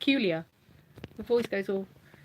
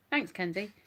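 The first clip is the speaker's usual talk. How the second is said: ordinary talk, nothing marked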